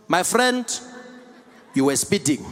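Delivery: loud South African traffic cop; South African policeman. Retold or imitated. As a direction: accuse in a heavy local accent. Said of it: "the Metro Police comes there".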